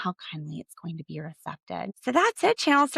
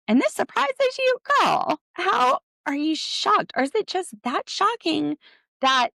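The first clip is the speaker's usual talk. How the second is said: sassy disbelief; 11 semitones higher, 5 dB louder than usual. Real, not acted. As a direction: tease them for being surprised, pitch way up. Mocking viewers' shock at Cody.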